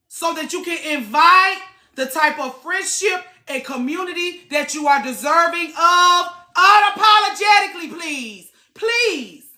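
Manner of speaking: full-force sermon about heartbreak; impassioned preaching. Real, not acted. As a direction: preach it hard at the audience. Preaching at the audience.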